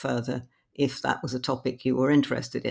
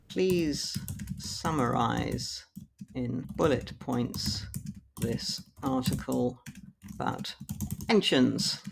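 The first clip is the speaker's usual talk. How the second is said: slow dictation; 3 dB quieter, slower than usual. Shown as a demonstration. Reading a typed prompt aloud.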